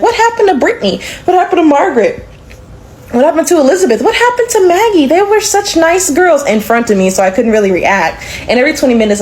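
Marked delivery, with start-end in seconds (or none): 0.0-6.4 high, pointed mother voice; disapproving mother. Retold or imitated. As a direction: needle her with fake sweetness. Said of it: "his mother would constantly ask questions like".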